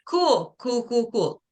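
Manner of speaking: high, dry sarcastic cool, trailing down. Real, not acted. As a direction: brush it off with mock cheer. Sarcastic reaction to useless advice.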